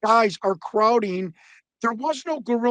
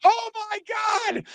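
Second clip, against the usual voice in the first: imitated commentator cry; 13 semitones higher than usual. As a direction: cry it out like a stunned ringside commentator. All of a sudden you hear.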